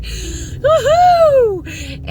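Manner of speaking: high, loud, drawn-out woohoo; excited whoop. Real, not acted. Vlogger back to feeling good, excited whoop. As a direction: let out a long celebratory whoop. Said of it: "I'm excited to see you all".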